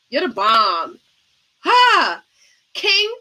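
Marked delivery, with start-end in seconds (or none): 1.6-2.2 a single high, loud "Ha!"; bright laugh bark. Real, not acted. Host cheering the panelist, one loud laugh. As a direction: burst out with one delighted laugh.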